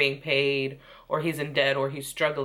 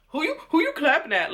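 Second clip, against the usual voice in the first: higher, quick mock sass; mock sassy Jackie; 9 semitones higher, 3 dB louder, faster than usual. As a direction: throw her words back at her, mocking. Mocking Jackie's contradiction.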